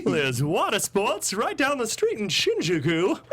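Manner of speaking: put-on high, slow old-time radio announcer; 1940s announcer. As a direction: ham up a vintage newsreel host. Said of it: "nineteen forties announcer".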